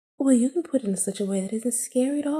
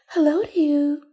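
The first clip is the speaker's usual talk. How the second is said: put-on higher, louder voice of the rival girl Felicia; Felicia's voice; 7 semitones higher, 4 dB louder than usual. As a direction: greet her the way Felicia would. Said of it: "No, that's more like Felicia's voice".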